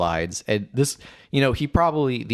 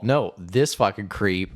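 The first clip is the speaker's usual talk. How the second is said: ordinary delivery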